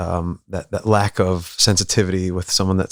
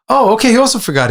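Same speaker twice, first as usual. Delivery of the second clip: high, loud eager mock; relieved elders; 12 semitones higher, 5 dB louder than usual. Elders excusing third boy, high loud relief. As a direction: wave it through, voice up high. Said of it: "They said".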